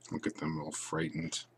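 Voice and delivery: hushed soft aside; whispered aside. Real, not acted. Reader whispering to the child about the monsters. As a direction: murmur it to the listener.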